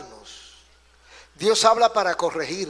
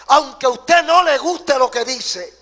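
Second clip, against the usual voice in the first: raised, louder preacher's voice; emphatic preaching; 5 semitones higher, 7 dB louder than usual. Real, not acted. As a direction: proclaim it forcefully to the congregation. Preacher's emphatic build before refrain.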